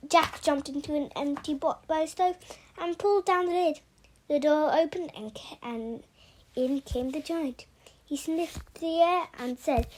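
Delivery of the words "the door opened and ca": ordinary delivery, nothing marked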